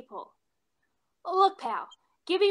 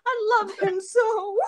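mock sob; 3 semitones higher, slower than usual. Hammy lovesick confession.

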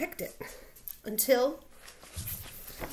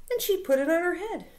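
ordinary delivery

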